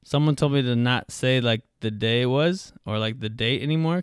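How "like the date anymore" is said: ordinary delivery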